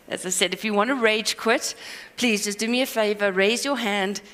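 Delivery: ordinary talk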